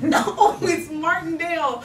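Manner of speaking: loud, high, with a laugh in it; mock outburst, half laughing; exasperated correction. Girl corrects operator who keeps mishearing her town, exasperated.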